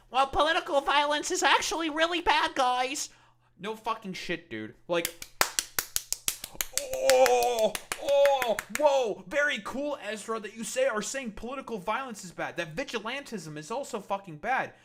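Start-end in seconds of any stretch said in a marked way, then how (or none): 0.1-3.1 much higher, louder whiny mock voice; mocking quote. Streamer mocking the liberal pundit's both-sides line, whiny high mock voice. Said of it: "spare me all this nonsense about, like". 6.8-14.7 much higher, mock-impressed oh whoa; sarcastic praise. Streamer sarcastically praising Ezra for saying violence is bad, high mock awe.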